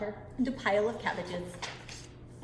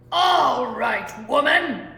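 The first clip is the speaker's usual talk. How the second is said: loud booming giant voice at the door; giant's bellow; 11 dB louder, much slower than usual. Acted. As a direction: bellow it like a huge angry giant. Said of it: "bellowed the giant at the door".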